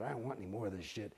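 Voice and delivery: low, quick, under the breath, fed up; muttered quit. Retold or imitated. Him telling auditors he's quitting, muttered low. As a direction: grumble it low and fast like you're done.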